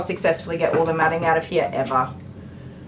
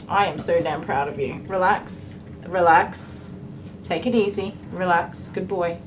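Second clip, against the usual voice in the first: normal talking voice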